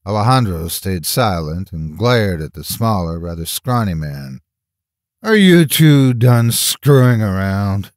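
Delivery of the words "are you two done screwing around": loud, slow, drawn-out impatient question; raised, exasperated voice. A narrator's reading. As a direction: scold two squabblers impatiently. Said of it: "asked impatiently".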